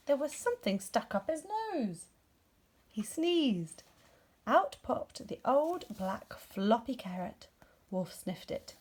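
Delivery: plain reading voice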